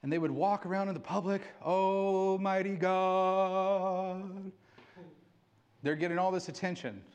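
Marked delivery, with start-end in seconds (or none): 1.6-4.5 mock showy prayer. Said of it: "The Pharisees would go out and they would pray".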